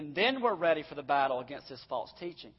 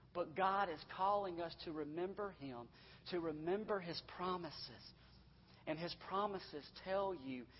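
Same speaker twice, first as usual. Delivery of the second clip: ordinary delivery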